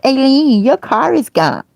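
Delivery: high, loud alarmed sister voice; sister's announcement. Retold or imitated. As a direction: blurt it out like the worried sister. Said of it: "my sister Alva said".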